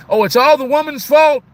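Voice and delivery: high, loud mocking voice of a blaming guy; mocking whiny guy. A man mocking guys who blame their women, high and loud. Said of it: "one of those guys that says".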